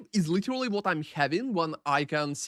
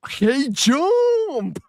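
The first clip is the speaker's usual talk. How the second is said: excited greeting; 8 semitones higher, 6 dB louder than usual. Real, not acted. Greeting a chat member.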